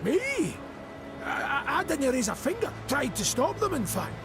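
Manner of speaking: high-pitched, stammering denial; indignant protest. Acted. Accused of the deaths by the queen, indignant protest.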